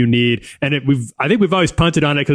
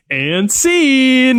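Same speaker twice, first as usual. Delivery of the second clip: theatrical sign-off; 10 semitones higher than usual. Retold or imitated. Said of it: "he comes back in like".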